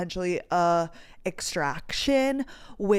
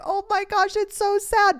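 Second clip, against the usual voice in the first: much higher, mock-pitying boyfriend voice; imitating Carter; 13 semitones higher than usual. Quoting boyfriend Carter filming the dog, high mock pity. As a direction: squeak it out, half laughing. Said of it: "He's like".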